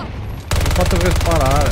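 combat callout. Real, not acted. Shooter match, firing at enemy.

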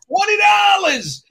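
high, loud, stretched-out yell; shouting back. Retold or imitated. Tony telling the groomsman what he paid, shouting back. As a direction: bellow the answer slowly across a room. Answer yelled across the room.

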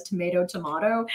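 "tomato, tomato" first with MAY, then MAH; two pronunciations. Shown as a demonstration. Recalling anytime somebody says it, "tomato, tomato" as MAY then MAH. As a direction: contrast American and English middles in "tomato, tomato". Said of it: "anytime somebody says".

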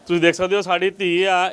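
ordinary talk, nothing marked

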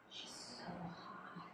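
slow, very soft whisper; whispered voices. Acted. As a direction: whisper it like a voice in a dream. Voices overheard in her dream.

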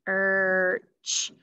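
"search" broken into s... er... ch; segmented sounds. Shown as a demonstration. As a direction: sound out "search" slowly with gaps between sounds. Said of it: "What is the third sound".

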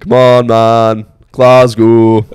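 loud, drawn-out mock Glaswegian; Glasgow accent. Friend showing off how Glasgow talks, mock Glaswegian accent. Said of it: "the Glasgow accent, like, something else".

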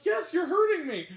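mock pained cry broken by laughter; laughing through a mock plea. Retelling a movie scene, laughing mock plea.

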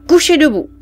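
the sergeant jokes to the mother; sergeant joking. Acted. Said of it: "dit le sergent". (sergeant qui plaisante, plus fort)

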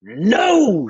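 loud, high, drawn-out no; emphatic loud refusal; shouted refusal. A narrator's reading. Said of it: "shouted Taft".

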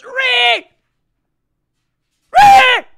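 huge high-pitched shout imitating an angry mom; mother's mock yell. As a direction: imitate a mom screaming a name through the house. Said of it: "I've never heard a, a louder yell. She shouts".

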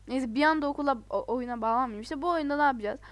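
ordinary talk

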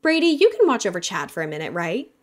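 teasing her brother with fake sweetness; facetious. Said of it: "she added facetiously".

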